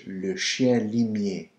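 chien limier said slowly, nasal chien; le chien limier, slow and clear; slow French. Shown as a demonstration. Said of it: "Le bloodhound in French".

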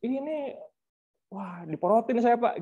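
lightly acted grumble to a superior; retold venting. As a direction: imitate a colleague confiding a grievance. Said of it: "dicurhatin sama sebagian kawan-kawan kan".

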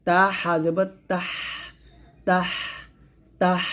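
তাহ with a long thin stream of air at the end; thin breathy h. Shown as a demonstration. Teacher drilling breath on ha, long airy h in তাহ. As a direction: let air out after তাহ. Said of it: "চিকন বাতাস বের করবেন".

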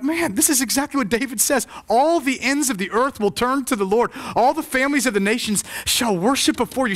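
high, driving sermon delivery; impassioned preaching. Acted. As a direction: declare the scripture to the whole room. Preaching the psalm with force.

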